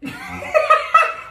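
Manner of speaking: shrill burst of laughter; high cackle. Real, not acted. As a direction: squeal of laughter.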